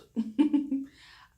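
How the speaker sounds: loud, high burst of laughter; amused laugh. Real, not acted. Speaker owning up to a lisp, amused laugh.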